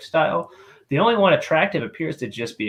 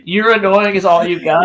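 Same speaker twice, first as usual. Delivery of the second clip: louder and higher, echoing in disbelief; incredulous mock echo; 5 semitones higher, 7 dB louder than usual. Real, not acted. Mocking a friend's weak comeback, loud and amused. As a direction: throw the line back, amused.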